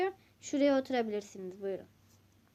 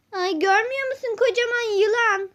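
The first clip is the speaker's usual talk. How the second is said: shrill panicked cry, louder and higher; frightened yelp; 9 semitones higher, 8 dB louder than usual. Acted. Customer panicking at the shopkeeper's big snake, frightened loud cry.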